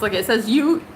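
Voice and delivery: ordinary delivery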